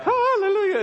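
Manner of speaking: high, exultant hallelujah; mock hallelujah. Preacher mocking ideal Sunday mornings, high mock exultation. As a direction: burst out in exaggerated joy, pitch soaring.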